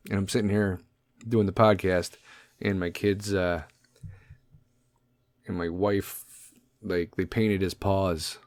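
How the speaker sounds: normal talking voice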